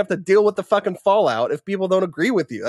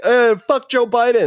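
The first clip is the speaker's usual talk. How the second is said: higher, louder, slow deadpan delivery; hammed-up defiant jab; mock blunt insult; 6 semitones higher, 5 dB louder than usual. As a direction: spit it out flatly then hang up. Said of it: "even if you were like".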